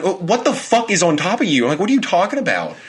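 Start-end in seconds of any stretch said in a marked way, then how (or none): 0.0-1.6 much higher, frantic question; alarmed. Retold or imitated. Boyfriend spooked in the dark, demanding answers in high alarm. Scared by sleep-talking girlfriend. 1.8-2.8 much higher, frantic question; alarmed. Retold or imitated. Boyfriend spooked in the dark, demanding answers in high alarm. Scared by sleep-talking girlfriend.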